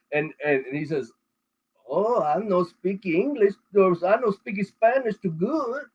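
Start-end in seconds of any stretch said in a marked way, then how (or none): 1.9-5.8 slow, higher mock Mexican accent; broken English imitation. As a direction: drag it out like the painter confessing, thick Mexican accent. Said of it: "he's speaking broken English".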